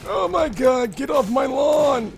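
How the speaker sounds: put-on high, slow, shouting old man; old man impersonation. Gamer mocking their own failed scream, old man impersonation. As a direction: yell like an old man, dragging out the words. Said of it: "my old man impersonation".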